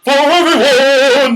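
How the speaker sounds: hammed-up Oprah announcing gifts for everyone. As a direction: belt it like a talk-show host handing out prizes. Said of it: "my Oprah voice".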